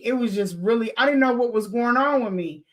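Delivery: ordinary talk, nothing marked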